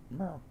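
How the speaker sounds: high soft mock meow. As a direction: meow softly at the cat. Meowing at the cat.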